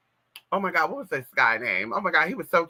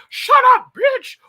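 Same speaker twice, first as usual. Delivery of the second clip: much higher, much louder put-on yell; yelling man voice; 10 dB louder, slower than usual. Mama on the phone yelling in her man voice. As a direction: bark it down the phone. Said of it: "with that man voice and say".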